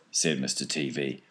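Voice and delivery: ordinary delivery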